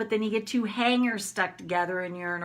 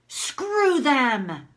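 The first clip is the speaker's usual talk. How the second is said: raised-pitch angry dismissal; demonstrated open anger; 4 semitones higher, 4 dB louder than usual. Shown as a demonstration. Woman alone finally lets herself vent about them, angry. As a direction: let it out forcefully. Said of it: "I can let myself have my anger".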